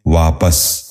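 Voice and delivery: واپس said as "vaa-pas", short a in the second syllable; correct pronunciation. Shown as a demonstration. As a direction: end واپس clearly in "-pas". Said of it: "درست تلفظ ہے".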